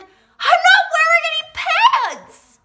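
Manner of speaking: high, slow, shouted cry of horror; hammed-up shriek of dismay; panicked wail. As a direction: wail it like the world is ending. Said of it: "turned to my friend ... said".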